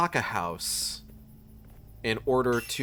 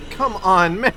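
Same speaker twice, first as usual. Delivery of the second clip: louder, higher plea at the game; loud exasperation; 7 semitones higher, 9 dB louder than usual. Real, not acted. Streamer pleading loudly with the game. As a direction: throw it at the screen, fed up.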